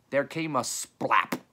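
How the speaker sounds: ordinary delivery, nothing marked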